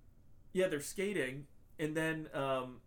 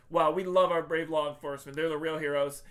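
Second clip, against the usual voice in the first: loud, showy mock admiration; mock gushing; 7 dB louder than usual. Host parodying a film's virtue signaling, mock gushing. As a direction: gush with put-on reverence, louder than usual.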